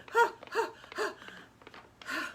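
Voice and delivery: high theatrical gasps, one after another; hammed-up huffing; shocked gasps. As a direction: gasp as if about to faint.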